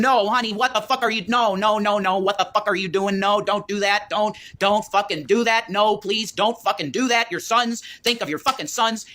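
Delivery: high, frantic reenacted pleading; retold scream. Man reenacting his scream at partner swallowing pills, high frantic pleading. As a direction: raise the pitch and plead urgently, reenacting panic. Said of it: "and I screamed".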